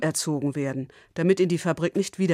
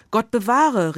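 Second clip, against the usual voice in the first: a louder, higher cry, Gott bewahre falling hard; exclaimed; 5 semitones higher, 5 dB louder, slower than usual. Acted. Frau Bartolotti to Konrad, a louder horrified exclaim. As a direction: Frau Bartolotti cries out in horror. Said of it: "rief die Frau Bartolotti".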